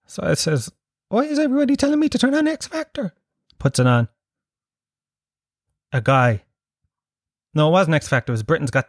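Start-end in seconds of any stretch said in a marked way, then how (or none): 1.1-3.1 very high, fast, squeaky-pitched bewilderment; baffled query. Retold or imitated. Comedian replaying his reaction to friends' messages, high and baffled. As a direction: rush it out high, mock-baffled.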